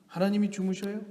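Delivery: ordinary talk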